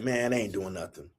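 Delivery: mocking doubters. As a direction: brush it off like the doubters did.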